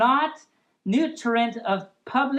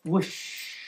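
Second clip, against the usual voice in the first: breathy whoosh of time flying; whooshing sound; 5 semitones lower, 7 dB quieter than usual. Acted. Narrator whooshes to show time flying by. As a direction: blow it like wind rushing past.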